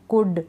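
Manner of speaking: कुड with a short vowel straight into D; repeated model word कुड, L left out; silent L. Shown as a demonstration. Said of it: "कुल्ड नहीं".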